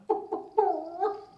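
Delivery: high, soft clucks, last one drawn out; clucking chicken. Acted. Chicken back at the library desk, high drawn-out clucking. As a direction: cluck like a hen, stretching the final squawk. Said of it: "The chicken says".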